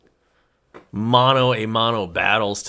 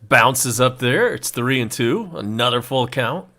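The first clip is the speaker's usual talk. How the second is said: play-by-play; 2 semitones higher than usual. Acted. Live baseball play-by-play call.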